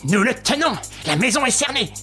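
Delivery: high triumphant call; triumphant cry. A narrator's reading. Ganimard crowing that the house is surrounded, triumphant. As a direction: crow in triumph. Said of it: "poussa un cri de triomphe".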